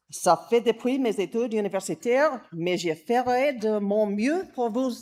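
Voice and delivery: English-speaking accent in French; rusty French with an English accent; foreign accent in french. Real, not acted. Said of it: "my garbled French".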